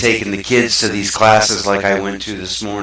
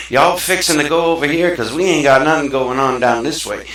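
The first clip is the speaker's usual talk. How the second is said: Southern accent; 5 semitones higher, 3 dB louder than usual. Shown as a demonstration. Showing how he could talk back home, a light Southern accent. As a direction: slip into a hint of a country Southern accent. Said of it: "I could talk like I'm from the South".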